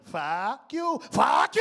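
put-on loud FUCK YOU in a Spanish accent, rising on YOU; shouted accented reading. Father rereads it, shouting.

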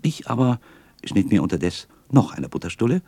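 ordinary delivery

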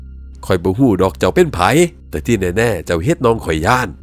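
loud, slow firm comeback; defiant retort. Acted. Brother tells spirit it scared his sibling, loud defiance. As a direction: answer the ghost forcefully, each phrase drawn out.

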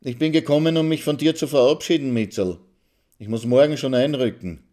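a hint of smiling pride; proud smile. A narrator's reading. Karl announcing he is off to the army, proudly smiling. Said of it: "lächelt der Karl stolz".

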